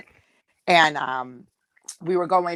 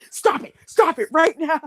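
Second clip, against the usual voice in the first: high-pitched mock urgency; acted-out mortified scolding; horrified protest; 10 semitones higher than usual. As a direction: imitate a horrified sister demanding a stop. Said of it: "She gets horrified".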